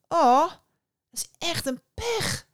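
loud, high startled exclamation; the fisherman crying out in dismay. Acted. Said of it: "riep de visser uit". (luide, hoge uitroep)

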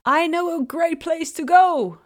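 mock call. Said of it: "riep hij".

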